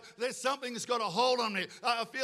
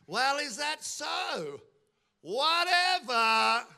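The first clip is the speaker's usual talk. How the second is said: drawn-out, scornful brush-off; couldn't-care-less retort, very slow; dismissive Pharaoh; 4 semitones higher, 5 dB louder, much slower than usual. Acted. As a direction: stretch it out slowly, higher, ending loud and uncaring. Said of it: "he does not care about".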